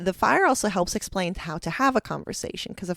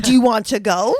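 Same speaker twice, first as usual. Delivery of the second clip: louder playful asking tone; mock question; 3 semitones higher, 10 dB louder than usual. Teasing an Australian friend, playful exaggerated question. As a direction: tease with an exaggerated ask. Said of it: "you forget the question words".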